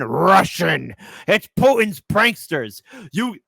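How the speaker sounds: a deliberately poor Russian accent put on for a joke; terrible Russian accent. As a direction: ham up a clumsy Russian accent. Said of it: "That's a terrible Russian, um, impression".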